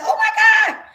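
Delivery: a high, loud shriek of joy, replayed; retold scream. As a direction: shriek it like the moment the news broke. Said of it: "I literally, like, screamed".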